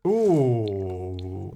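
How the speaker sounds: long drawn-out "ooh", sliding down; slow, falling coo of interest; intrigued ooh. Real, not acted. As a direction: let an impressed ooh glide downward. Reacting to a carpet they like.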